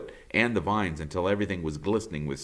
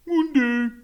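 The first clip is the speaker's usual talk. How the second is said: high raccoon greeting; 6 dB louder than usual. Acted.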